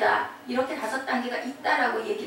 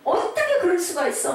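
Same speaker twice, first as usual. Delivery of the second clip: higher, indignant protest; acted anger; 6 semitones higher than usual. Bereaved parent at the second stage, acted indignant outburst. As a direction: protest in acted outrage, pitched up. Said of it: "막 불같이 화가 나".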